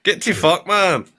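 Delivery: high Scottish heckler's yell; put-on Scottish accent. Spoofing a local wrestling show, high Scottish-accented shout. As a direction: shout it like an outraged Scottish fan. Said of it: "that accent was phenomenal".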